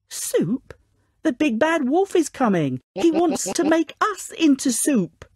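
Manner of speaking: high-pitched storybook panic; alarmed. A narrator's reading. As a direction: warn the others in a high, urgent voice. Pigs panic at coming wolf.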